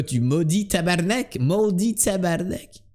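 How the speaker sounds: comic Québécois cursing; Québécois accent. Acted. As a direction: swear in a put-on Québécois accent. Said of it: "quand tu imites les Québécois".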